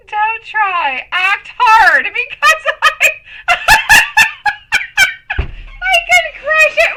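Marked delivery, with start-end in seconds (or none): 1.1-7.0 words squeezed out through laughter; slow, giggling through each word; laughing. Real, not acted. Laughing fit in the studio.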